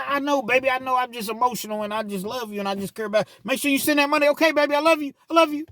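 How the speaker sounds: high mock cussing then pleading caller; squeaky caricature of a jailed man on the phone. Jailed man cussing girlfriend then begging money, high mock voice. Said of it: "cuss this girl out the whole time on the phone".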